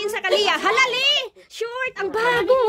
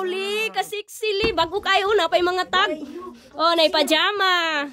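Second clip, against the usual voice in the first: ordinary delivery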